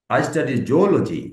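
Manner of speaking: very slow, clear model sentence with the stress on zoology. Shown as a demonstration. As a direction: spell it out slowly for the class and lean on zoology. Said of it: "এভাবে আপনাকে বল-, বলতে হবে".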